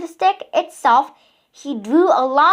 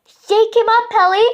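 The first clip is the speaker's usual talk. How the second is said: high, loud eager cry; cried out; 6 semitones higher, 4 dB louder than usual. A narrator's reading. Giraffe urging the pelican on, eager high cry. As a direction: cheer the pelican on. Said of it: "cried the giraffe".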